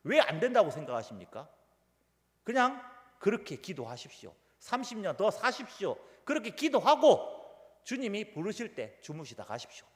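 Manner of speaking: mock-angry pastor, peaking loud midway; retold scolding. Pastor scolding elderly members to keep praying, mock-angry. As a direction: scold them with exaggerated insistence. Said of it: "제가 불같이 화를 냅니다".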